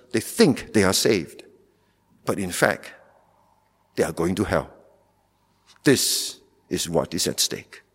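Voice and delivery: normal talking voice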